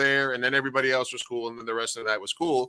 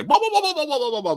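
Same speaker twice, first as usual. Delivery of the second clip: high singsong blah blah, mocking their gossip; mocking chatter; 4 dB louder, faster than usual. Bartender mocking the drunk woman's friends, high singsong. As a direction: sing the blahs up high, dismissive.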